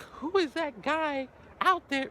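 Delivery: high, slow puzzled voice; mock a curious onlooker, high; high slow mock bystander; imitating onlooker. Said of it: "someone's like".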